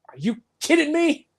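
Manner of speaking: high-pitched incredulous question; imitating an exasperated man. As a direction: squeak it out in mock disbelief. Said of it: "Max is like".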